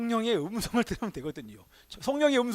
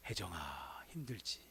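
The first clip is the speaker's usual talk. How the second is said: much lower, much softer, tender voice; tender comfort; 13 semitones lower, 16 dB quieter, slower than usual. Retold or imitated. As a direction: comfort her softly like a loving God. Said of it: "주님은 여러분의 이름을 부르십니다". (아주 낮고 부드럽게 위로하는 목소리)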